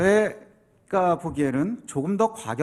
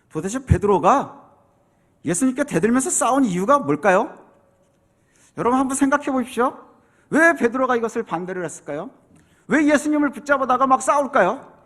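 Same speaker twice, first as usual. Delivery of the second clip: normal talking voice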